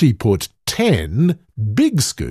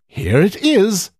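Hedda's voice; 8 semitones higher than usual. A narrator's reading. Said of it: "said Hedda".

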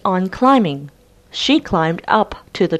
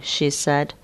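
usual speaking voice

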